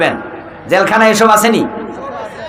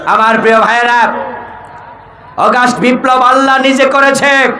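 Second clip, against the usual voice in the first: higher, declaiming sermon voice; fervent preaching; 8 semitones higher than usual. Real, not acted. Preacher hails the August revolution to brothers, fervent preaching. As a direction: proclaim it to the whole crowd.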